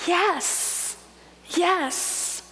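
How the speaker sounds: normal talking voice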